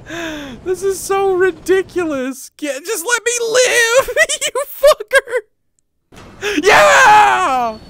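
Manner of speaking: very high, loud desperate yelling; exasperated screaming. Real, not acted. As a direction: scream at the game for mercy. Screaming at game to let them survive.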